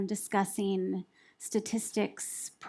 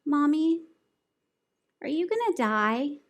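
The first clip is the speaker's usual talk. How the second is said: put-on higher-pitched little child voice; young daughter's casual question; 6 semitones higher, 4 dB louder than usual. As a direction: ask it high and casually, imitating a small daughter. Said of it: "and casually says".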